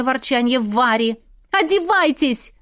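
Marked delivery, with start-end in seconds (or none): 1.5-2.5 higher-pitched hurried call; urgent hurrying. A narrator's reading. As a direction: hurry them along, voice lifted. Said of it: "торопила их я". (торопливо, высоким голосом)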